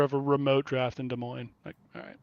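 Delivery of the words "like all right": whispered sarcastic aside; whispering. Real, not acted. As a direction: breathe it out dryly. Unvoiced muttered reaction.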